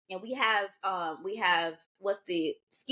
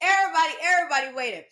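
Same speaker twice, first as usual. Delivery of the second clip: excited; 7 semitones higher, 6 dB louder, faster than usual. Real, not acted. Building up the proposal suspense.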